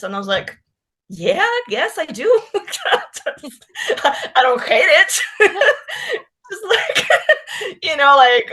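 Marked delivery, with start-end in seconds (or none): 1.1-7.8 high, giggly answer between laughs; breaking into giggles mid-sentence; laughing through words. Real, not acted. Giggling, retelling her answer. 7.8-8.5 laughing. Real, not acted. Giggling on past own answer.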